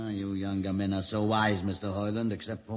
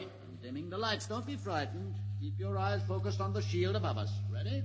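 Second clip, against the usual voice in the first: soothing; 9 semitones higher, 3 dB quieter than usual. Acted. Soothing captive before reveal.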